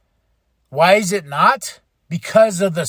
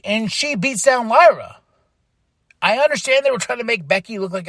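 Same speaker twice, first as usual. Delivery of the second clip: ordinary talk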